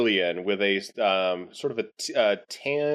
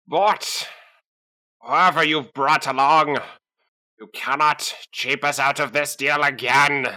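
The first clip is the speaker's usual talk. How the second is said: alien voice. Acted. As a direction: bark like a shifty alien. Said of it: "the alien shouts".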